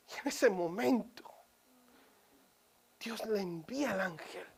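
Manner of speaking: ordinary talk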